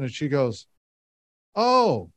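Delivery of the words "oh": surprised oh. Retold or imitated. Caller recognizes his name.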